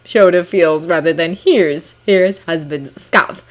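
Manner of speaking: usual speaking voice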